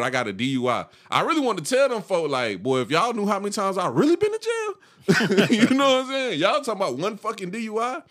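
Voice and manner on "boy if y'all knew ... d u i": high, laughing boast; a man laughing off his record to his bosses; laughing brag. Retold or imitated.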